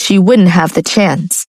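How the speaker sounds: ordinary delivery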